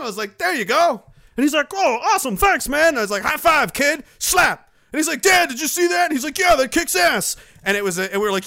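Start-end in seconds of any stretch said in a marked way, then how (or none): 0.4-7.4 excited retelling. Retold or imitated. Retold excited voices, high loud.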